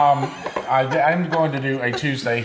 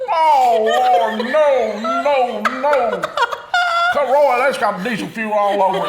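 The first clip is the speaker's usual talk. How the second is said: high, loud panicked dad shout; loud alarmed father; 10 semitones higher, 4 dB louder, slower than usual. Retold or imitated. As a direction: yell like a scared father. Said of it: "I remember him saying that loud".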